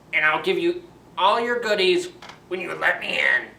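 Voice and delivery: high, loud wolf faking a mother; wolf trying to sound like mom, too harsh. Acted. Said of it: "his wolf voice".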